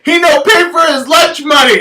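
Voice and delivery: very high, loud shout in broken English; accusing lunch lady. Retold or imitated. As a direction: tattle loudly to the teacher.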